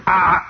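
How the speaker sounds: loud open-mouthed toad "ah"; toad croak. Acted. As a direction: blurt it like a giant toad. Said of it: "opens its mouth and goes".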